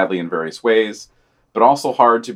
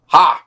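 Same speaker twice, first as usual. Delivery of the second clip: short laugh; 6 semitones higher, 7 dB louder than usual. Real, not acted. Laugh after reading quote.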